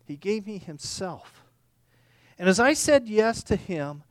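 ordinary delivery

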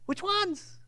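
pleading questioner. Acted. Man repeats, pressing Jesus.

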